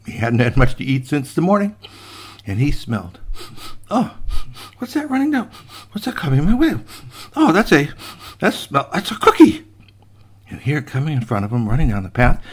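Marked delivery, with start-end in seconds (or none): 3.9-9.6 high voice broken by sniffs; hungry sniffing raccoon. Acted. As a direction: sniff between phrases, growing excited.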